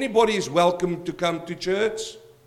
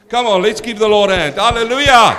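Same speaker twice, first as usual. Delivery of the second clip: rousing shout; 3 semitones higher, 8 dB louder than usual. Real, not acted. Preacher rousing the congregation.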